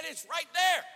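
high, loud insistence; emphatic shout. Retold or imitated. Cashier repeating himself to a baffled customer, shouted.

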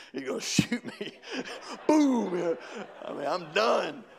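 high, loud laughing fear; hammed-up terror, cracking up; laughing mock panic. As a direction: laugh through the mock dread. Retelling fear of dad, laughing.

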